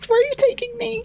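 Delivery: playful demand. Real, not acted. Cohost teasing her partner about their anniversary plans, high and coy. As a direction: coyly demand a treat.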